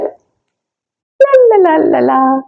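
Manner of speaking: high, lilting la la la, unbothered; carefree sing-song. Retold or imitated. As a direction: playfully hum through the chaos. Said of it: "you're calm".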